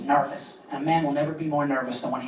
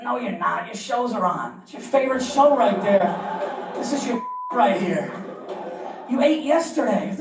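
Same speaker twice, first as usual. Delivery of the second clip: coaxing dad; 7 semitones higher, slower than usual. Retold or imitated. Coaxing daughter to stay put.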